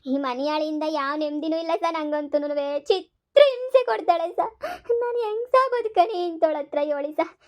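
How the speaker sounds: high, drawn-out put-on sobbing; hammed-up crying plea; tearful wailing. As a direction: wail in despair to win the officer's pity. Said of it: "ಅಳ್ಬೇಡಿ ಅಜ್ಜಿ".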